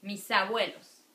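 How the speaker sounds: Mis abuelos linked as mi-sa-buelos. Shown as a demonstration. Teacher to students, linking consonant into vowel. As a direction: carry the s into abuelos. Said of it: "it sounds like misa".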